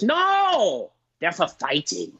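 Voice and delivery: imitating the Thai bartender's accent, high and abrupt on No. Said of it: "if you're offended by me doing his accent".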